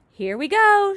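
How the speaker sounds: high, loud excited cry; excited shout. A narrator's reading. Grasshopper cries out to all as the peach heaves, excited shout. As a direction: shout it, hopping with glee. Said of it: "shouted the old green grasshopper".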